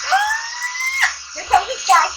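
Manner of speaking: high shouted thrill; real excited cry; delighted shriek. Real, not acted. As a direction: squeal as the sky appears. Sky appears under heat.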